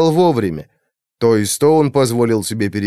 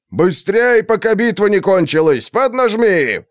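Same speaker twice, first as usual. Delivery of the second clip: higher, urgent yelled order; yelling commander; 7 semitones higher than usual. A narrator's reading. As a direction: push the troops on, yelling. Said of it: "орал Блэкмор".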